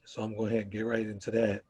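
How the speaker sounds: ordinary delivery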